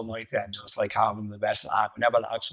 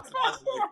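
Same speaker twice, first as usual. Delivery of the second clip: high giggling burst; a sudden high laugh; high burst of laughter. Real, not acted. Laughing at the memory.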